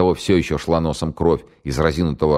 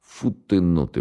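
восхищение; 3 semitones higher, slower than usual. Acted. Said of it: "восхитился старший майор".

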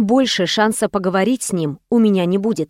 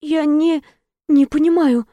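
heroine confused; 6 semitones higher than usual. Acted.

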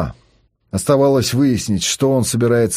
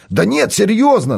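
raised, higher insistence; insisting; 9 semitones higher than usual. Acted. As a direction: insist you mean it, pitch up. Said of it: "настаивал он".

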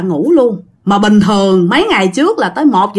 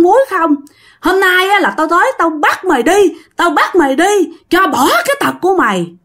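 fierce scolding; 8 semitones higher than usual. Retold or imitated. Shadow man berating the girl for her loose mouth, furious. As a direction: scold her hard and fast, spitting every word. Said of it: "chửi với một cái giọng rất là hung dữ, là giọng đàn ông".

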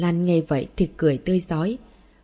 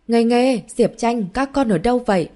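loud, higher worried calling out; gọi lớn lo lắng; 6 semitones higher, 4 dB louder than usual. A narrator's reading. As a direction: call the children anxiously across the garden. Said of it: "Cô lo lắng gọi lớn". (gọi to đầy lo lắng)

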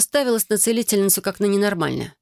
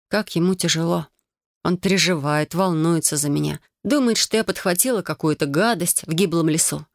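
ordinary delivery

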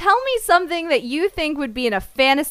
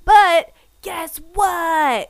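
very slow, drawn-out, higher tease; teasing build-up; 5 semitones higher, 4 dB louder, much slower than usual. Real, not acted. Reviewer baiting co-host with a plot twist, teasing. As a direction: stretch each word to set up a reveal.

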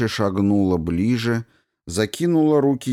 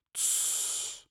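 hush; 14 dB quieter than usual. A narrator's reading. Queen hushes him.

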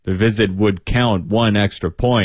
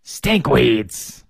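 put-on sharp old woman. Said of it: "said the other old woman sharply".

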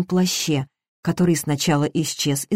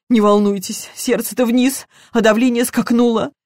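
«не волнуйтесь» thrown back higher, with bitter irony; the housekeeper mocks the officer's words, agitated; ironic echo; 5 semitones higher than usual. Ironic retort to the reassurance.

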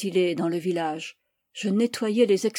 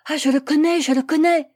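high, loud burst of recognition; excited; 7 semitones higher, 6 dB louder than usual. A narrator's reading. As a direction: exclaim eagerly at a poem you know. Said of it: "Hyun s'excita". (exclamation excitée et joyeuse)

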